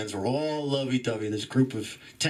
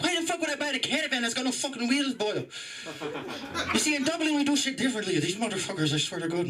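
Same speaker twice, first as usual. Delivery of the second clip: high-pitched, hammed-up Irish accent; 11 semitones higher, faster than usual. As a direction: slip into a rowdy, put-on Irish brogue. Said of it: "I'm Irish, I can just tap into it".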